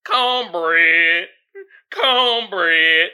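loud, mocking exaggerated Southern accent; imitated Southern drawl, punched out; mimicked cornbread accent. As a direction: parrot her put-on Southern accent. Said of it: "love your accent right now".

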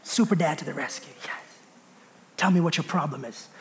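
playfully heroic under the breath; hushed mock hero. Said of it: "I was like".

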